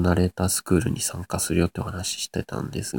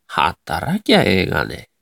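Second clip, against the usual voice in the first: slow Nagoya dialect answer; 名古屋弁; 2 semitones lower, much slower than usual. Shown as a demonstration. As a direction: draw it out in Nagoya intonation. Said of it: "名古屋弁っぽい言葉を喋ってみて". (名古屋弁でゆっくり伸ばして)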